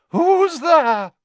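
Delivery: loud, high theatrical call. A narrator's reading. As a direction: cry out into the unknown, drawn high. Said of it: "And then I called out".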